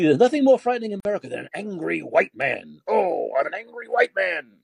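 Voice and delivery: high-pitched mock dread; mock alarm. Host ridiculing an alarmist headline, mock alarm. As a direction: play up the scare, voice lifted.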